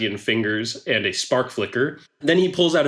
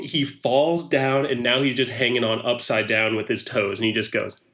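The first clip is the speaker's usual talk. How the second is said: usual speaking voice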